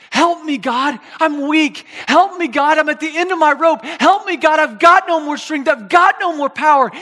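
loud, high cry for God's help; preacher's impassioned acted prayer; desperate plea. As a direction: plead at the end of your strength.

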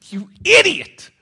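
high, loud, slow exasperated jab; exasperated insult. Acted. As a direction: throw it at him high and loud, fed up. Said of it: "Okay, he doesn't say that".

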